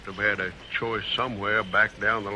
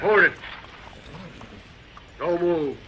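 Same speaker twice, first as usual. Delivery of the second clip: sharp challenge; 5 semitones higher than usual. Acted. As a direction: stop them cold with a raised voice. Gunman challenges someone in dark.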